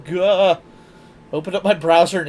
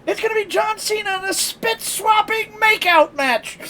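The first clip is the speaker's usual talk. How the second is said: mock announcer; 14 semitones higher than usual. Quoting the prank call announcer.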